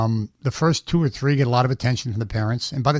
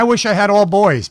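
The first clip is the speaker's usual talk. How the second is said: mild higher, louder mom quote; retold mother; 8 semitones higher, 6 dB louder than usual. Comedian quotes mother wishing for boys, raised higher voice. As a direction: lightly act out a mother's loud wish. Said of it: "My mother used to say".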